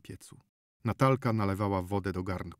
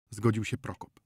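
plain reading voice

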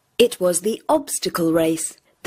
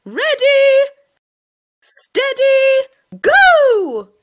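high, loud teacher's shout; starting a race, loud; 6 dB louder, slower than usual. Acted. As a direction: call the race start across the field.

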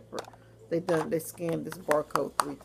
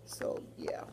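whispered so yeah; 8 dB quieter than usual. Real, not acted. Vlogger trailing off about the spot treatment, whispering.

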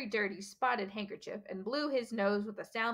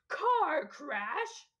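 roaring; 7 semitones higher, 3 dB louder than usual. A narrator's reading. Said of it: "roared Hagrid".

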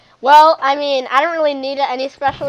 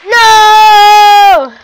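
loud high scream of no; panicked shout; 8 semitones higher, 14 dB louder than usual. Real, not acted. Panicked cry in fight.

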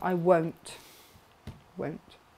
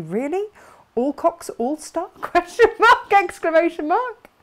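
high, loud mock disbelief, punctuation spoken aloud; incredulous read-out; 8 semitones higher, 8 dB louder than usual. Host reading Emma's teasing message, mock incredulous. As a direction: read it out in exaggerated, rising disbelief.

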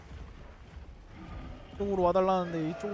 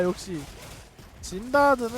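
ordinary talk

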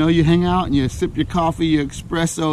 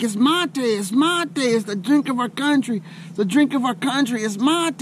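higher friendly voice of the locals; locals explaining; 8 semitones higher than usual. Retold or imitated. Argentinians tell the visitor about their drink, high and proud. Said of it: "they were like".

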